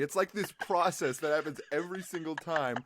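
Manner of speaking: ordinary talk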